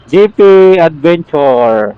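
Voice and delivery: ordinary delivery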